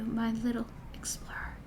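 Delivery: dying father's faint whisper; weak whisper. Acted. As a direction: barely breathe the words out. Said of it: "he said in such a weak voice".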